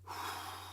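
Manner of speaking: soft unvoiced sigh of breath; demo sigh out. Shown as a demonstration. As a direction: let the air go in a sigh. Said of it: "and breathing out".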